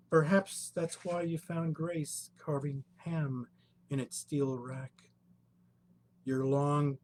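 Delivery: measured, lyrical poem recital; poetry reading. Acted. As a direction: read each line like a poem. Poet reads poem aloud.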